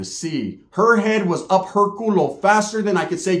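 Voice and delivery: ordinary talk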